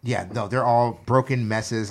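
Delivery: usual speaking voice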